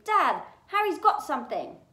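loud, high tell-tale call; tattling shout. A narrator's reading. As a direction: alert dad urgently, telling on the other boy. Dudley calls out, telling on Harry.

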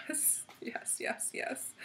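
breathless hushed yes; soft whispered glee; whispered yeses. Real, not acted. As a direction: whisper it to yourself.

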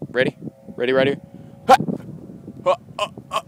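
loud sharp throw cries; short loud throw yelps; frisbee throw shouts. Real, not acted.

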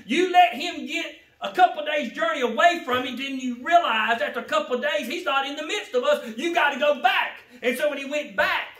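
loud, high fervent preaching; preaching at full force. Acted. Preacher urging the congregation to return to God, loud. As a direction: drive each line home to the pews.

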